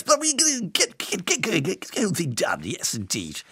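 high stuttering Boris voice; Boris impression. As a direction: bluster and stumble like a politician. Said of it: "than Boris".

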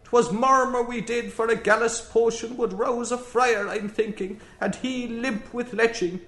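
keen it in a querulous Irish brogue. Acted. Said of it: "keened in querulous brogue".

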